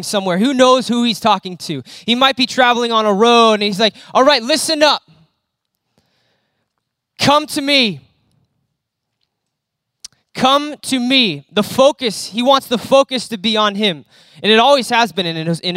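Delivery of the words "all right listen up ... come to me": raised, higher call to a crowd; preacher voicing Jesus hailing passersby. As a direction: call the travellers over, then slow down. Jesus calling crowds on road.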